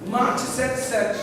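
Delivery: slow, calmer preached phrase; preaching. Acted. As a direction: bring it down, slow and firm.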